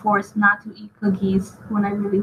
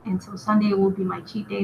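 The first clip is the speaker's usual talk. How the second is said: ordinary delivery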